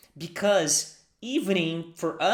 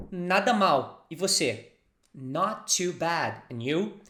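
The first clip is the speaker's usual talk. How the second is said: usual speaking voice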